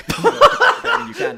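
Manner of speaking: words breaking out through loud laughter; laughing. Real, not acted. Creator cracking up at his own joke, laughing loudly.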